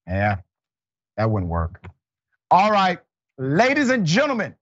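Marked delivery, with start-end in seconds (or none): 2.5-4.6 higher, showman welcome; big show welcome; announcer intro. Acted. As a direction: announce it like a ringmaster. Host announcing the bullpen segment.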